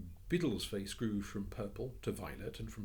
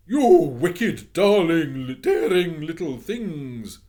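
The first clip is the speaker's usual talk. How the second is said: loud, high, slow outraged shriek; furious cry; 10 semitones higher, 21 dB louder, much slower than usual. Acted. Missus Biddle shrieking at intruding children, furious. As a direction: scold the children at full volume. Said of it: "she cried".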